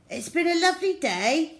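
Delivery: put-on high, fast cheerful boy voice; chirpy kid saying goodnight; child voice. Henry's bedtime line in story.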